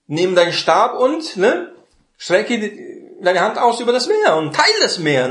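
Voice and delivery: high, ringing command to Moses; God commanding. Retold or imitated. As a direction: call the order out across the sea. God's command at the sea. (Gott befiehlt Mose hoch und feierlich)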